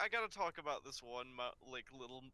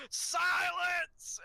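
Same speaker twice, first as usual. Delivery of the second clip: much higher, loud shouted order; booming command; 9 dB louder than usual. Retold or imitated. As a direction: roar it like an angry principal. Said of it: "Principal Pixie Frog just goes".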